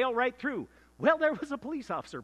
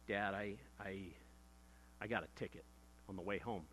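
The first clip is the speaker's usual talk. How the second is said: much softer, halting, afraid to say it; timid confession; 8 semitones lower, 13 dB quieter than usual. Retold or imitated. Boy confessing a traffic ticket to his strict dad, timid and much softer. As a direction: barely get the words out to your father.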